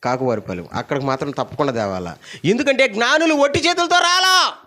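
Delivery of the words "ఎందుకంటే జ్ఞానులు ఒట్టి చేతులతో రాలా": high, loud preaching cry; preaching at full force. Acted. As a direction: preach it out with fire. Preacher's emphatic point. (ఉత్సాహంగా బోధన)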